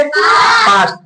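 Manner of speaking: Past shouted high; loud answer call. Shown as a demonstration. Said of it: "क्या जोड़ देंगे?". (ज़ोर से ऊँचा पास्ट)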